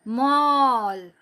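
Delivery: मॉल drawn out very slowly with a long open ऑ; slow model word. Shown as a demonstration. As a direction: stretch मॉल for the class. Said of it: "मैं teacher के पीछे बोलिए".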